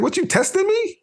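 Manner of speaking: very high-pitched challenge; retold incredulity. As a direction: act out an incredulous comeback.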